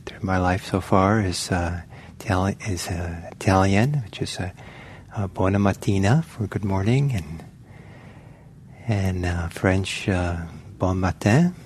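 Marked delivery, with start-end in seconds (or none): none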